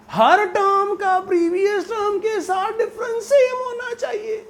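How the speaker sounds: very high, strained comic cry held on one note; Jethalal getting electrocuted. Pretend. Teacher repeating the rule to his class, mock electrocuted wail. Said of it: "Jethalal की याद आ गई जब उसको current लग गया".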